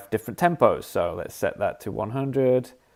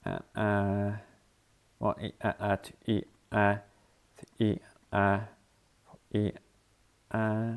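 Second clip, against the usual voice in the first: counting the rhythm; 8 dB quieter than usual. Shown as a demonstration. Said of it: "that's the rhythm".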